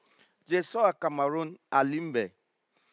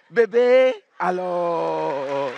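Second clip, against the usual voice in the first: put-on drawn-out, fond call of baby; imitating a couple's tender pet name; mock lover's call; 3 semitones lower, 4 dB louder, much slower than usual. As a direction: coo it like a lover calling his sweetheart. Repeats the couple's phrase.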